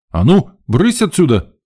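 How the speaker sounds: high, loud shooing call; brisk scolding holler; shouted. A narrator's reading. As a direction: chase kids away on the run. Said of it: "на ходу крикнул Женька".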